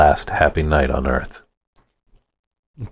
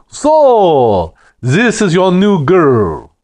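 big, loud, higher Russian bellow; booming Russian accent; 12 semitones higher, 5 dB louder, much slower than usual. Acted. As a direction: boom it out in a thick Russian accent. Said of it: "his voice boomed like a backfiring car with a Russian accent".

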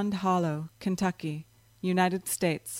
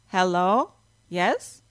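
a lightly voiced strange phone voice, accented but clear; reedy hollow voice; 4 dB louder, slower than usual. Phone voice answers Rachel's call, lightly reedy and hollow. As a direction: answer like something not quite human, reedy and hollow. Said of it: "had a reedy, hollow quality".

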